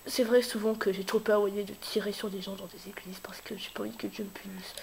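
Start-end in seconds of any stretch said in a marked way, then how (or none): 1.6-4.7 hushed whisper; playful church whisper. Pretend. Jokes about God punishing him.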